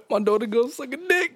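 high-pitched hammed-up wail through laughter; mock crying. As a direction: imitate a sobbing dad, voice way up high. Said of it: "you just see this dad crying".

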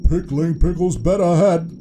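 a hint of comic old-fashioned oath; grandpa character. A narrator's reading. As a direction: accept the invitation with mild gusto. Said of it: "he said".

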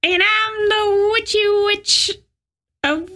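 high, slow witchy voice; witch voice. Pretend. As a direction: introduce yourself as a witch. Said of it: "very bad witch voice".

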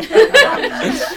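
high slow laughing words. Real, not acted. Laughs at coincidence.

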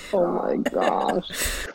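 slow, breath-only disbelief; stunned whisper. Real, not acted. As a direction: whisper it slowly, as if truly stunned. Stunned by the outrageous plot.

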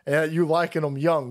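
normal talking voice

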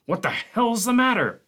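loud, high demand; roused man barging in with an extinguisher. Acted. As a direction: bark out the question. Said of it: "Barkley demanded".

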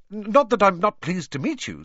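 rushed politeness; hurried. A narrator's reading. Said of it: "said Harry quickly".

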